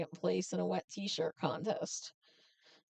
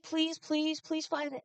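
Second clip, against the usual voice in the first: imitated begging, higher-pitched; pleading; 6 semitones higher than usual. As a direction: plead like a pestering sister. Sister begs him to come, acted.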